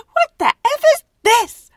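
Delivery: very high, loud what the F; imagined doctor shocked by candy panties; shocked disbelief. Retold or imitated. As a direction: squeal it in laughing shock. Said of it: "she probably opened it up and thought".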